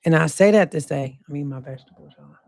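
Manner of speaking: usual speaking voice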